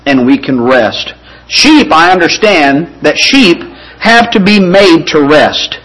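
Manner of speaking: ordinary talk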